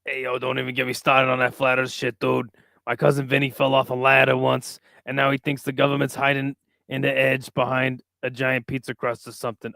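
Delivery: lightly voiced Italian guy accent; a hint of Italian guy accent. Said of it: "he's wanting me to do a Italian guy accent".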